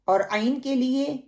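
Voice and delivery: usual speaking voice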